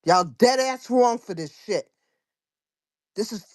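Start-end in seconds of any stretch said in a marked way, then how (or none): none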